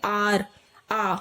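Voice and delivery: "आर" then the long "आ" alone; AA sound. Shown as a demonstration. Teacher answering whose sound is heard, "आ" isolated from "आर". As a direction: isolate the opening aa of "आर". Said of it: "sound किसकी आ रही है".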